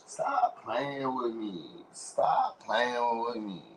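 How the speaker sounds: a lightly put-on, drawn-out warning; slow put-on warning. Repeating his warning to viewers, slow and put-on. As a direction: drag each word out slowly in an altered voice.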